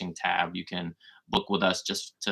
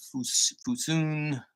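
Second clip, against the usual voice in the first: tentative stab at a name, pitched up at the end; unsure pronunciation; 8 semitones higher, much slower than usual. Real, not acted. Greeting a viewer by an unfamiliar name, unsure. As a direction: sound out an unfamiliar name hesitantly. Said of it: "not sure how to say that one".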